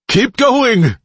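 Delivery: high, loud trembling yell; shaky shout. Acted. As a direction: shout with a shaking voice. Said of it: "he shouted in a shaky voice".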